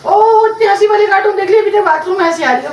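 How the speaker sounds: high, loud exclamation to someone off; exclaiming loudly. Real, not acted. Replying to someone still laughing at cartoons, exclaiming loudly. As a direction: react loudly, pitch jumping up.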